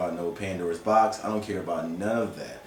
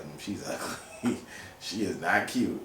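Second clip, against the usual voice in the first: catty judging; 3 semitones lower, 6 dB quieter, slower than usual. Retold or imitated. Judging ex's new girlfriend.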